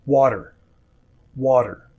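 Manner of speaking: water, the T tapped quickly like a D; flapped T. Shown as a demonstration. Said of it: "t will often sound like d".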